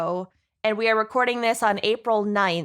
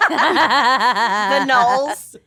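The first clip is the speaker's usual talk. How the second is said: laughing; 5 dB louder than usual. Real, not acted. Laughing name of the couple.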